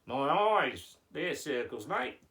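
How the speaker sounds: put-on Aussie puppet, rising on "mate"; hammed-up Australian character; Australian accent. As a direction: answer as a broad Australian. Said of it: "you're going with the Australian accent".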